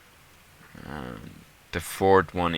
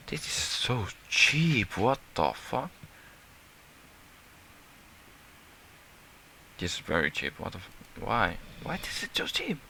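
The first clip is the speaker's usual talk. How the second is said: incredulous surprise; 4 semitones higher, 6 dB quieter than usual. Real, not acted. Surprised by cheap recipe.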